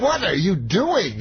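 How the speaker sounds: high, drawn-out indignant question; lightly mimicked TV interviewer, pitched high; Barbara Walters. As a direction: draw it out in mock disbelief. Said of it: "Barbara Walters goes".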